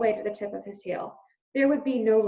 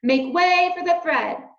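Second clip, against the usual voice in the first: louder, even higher call; crying out; 9 semitones higher, 7 dB louder than usual. A narrator's reading. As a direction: call out again, pushing through. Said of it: "cried a mouse".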